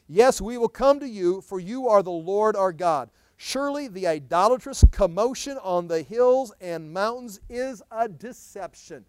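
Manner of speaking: loud, ringing scripture reading; full-voiced preacher proclaiming; preaching at full force. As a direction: proclaim it to the whole hall.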